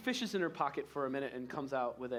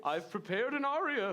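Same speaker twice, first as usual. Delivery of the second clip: proud Santa; 8 semitones higher, 4 dB louder than usual. Acted. Santa announces an aria.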